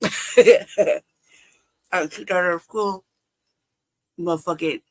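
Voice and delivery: ordinary talk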